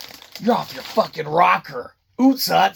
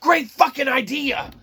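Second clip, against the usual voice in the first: mock excited exec; 7 semitones higher than usual. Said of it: "They're like".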